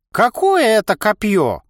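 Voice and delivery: high, loud, slow incredulous creak; surprised creaky old man. Acted. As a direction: gape at it like a baffled old man. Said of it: "удивился тренер".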